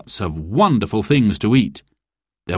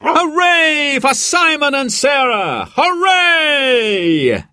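cheering shout; slower than usual. A narrator's reading. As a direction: shout hooray like a happy crowd. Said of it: "everyone shouted together".

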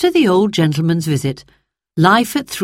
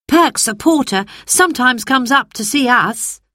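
put-on boy voice; 7 semitones higher than usual. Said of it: "said Peter".

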